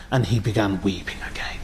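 soft, nearly whispered with grief; hushed sorrow. A narrator's reading. Preacher telling how Abu Bakr wept, hushed whisper. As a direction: let the voice sink to a breath of sorrow.

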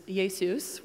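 Jesus as Greek "yay-SOOS"; Greek pronunciation. Shown as a demonstration. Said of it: "with the Y".

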